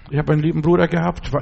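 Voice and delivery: ordinary delivery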